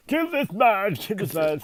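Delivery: high mock-tearful pleading; hammed-up dying warrior's plea; Vegeta. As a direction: beg in a put-on cartoon voice. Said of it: "He was crying".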